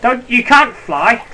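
high loud slow yell; pleading yell. Real, not acted. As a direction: yell after the chicken. Chasing chickens, yelling.

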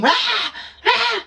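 high laughing yell "Rah!" like a construction worker; playful bellow, very high; mock rough roar. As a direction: roar out of anesthesia, hammed up. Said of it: "Lumalabas ang pagka-construction worker".

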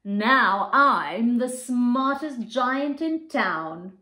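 slow, puffed-up and pleased; proud. Acted. Giant admiring his new clothes, proud and slow. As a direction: boast a little, chest out. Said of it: "he said proudly".

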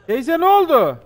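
sudden loud call to the crying auntie; startled shout. Real, not acted. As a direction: yell out in alarm. Startled by a crying woman in game. (ağlayan teyzeye bağırarak seslenme)

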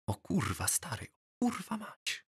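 very soft, stunned swearing; whispered. A narrator's reading. Lalu to his deskmate about the new girl, whispered. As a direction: whisper it to your pal. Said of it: "szepnął do mnie Lalu".

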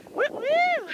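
high, loud whooping cheer; excited cheer. Real, not acted. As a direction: whoop it out with joy. Proud cheer mid-run.

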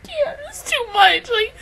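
loud laughing sob; laugh-crying. Real, not acted. Fan laugh-crying over two favorites in a scene. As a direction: laugh and cry at once.

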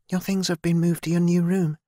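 put-on a friendly young maid explaining; young servant girl Kira. A narrator's reading. Narrator voices Kira.

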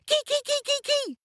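very high monkey chatter; monkey screech. A narrator's reading. The monkey shouting at ogres, high screech. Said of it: "The monkey shouted".

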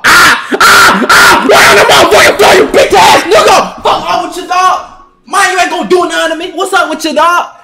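high, loud gloating yell; triumphant shout. Real, not acted. Gamer gloating after dropping his opponent, triumphant shout. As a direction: roar over him on the floor.

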